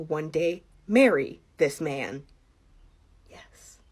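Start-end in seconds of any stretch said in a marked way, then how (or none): 3.3-3.8 barely audible whispered admission; tiny, hushed confession; reluctant whisper. Acted. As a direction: whisper it under your breath. Reluctant answer in self-questioning bit.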